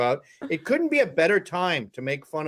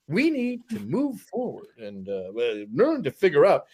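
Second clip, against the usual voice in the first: put-on Roger Stone accent; 3 semitones lower than usual. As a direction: drag it out like a political operative. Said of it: "he almost talks like Jared Taylor".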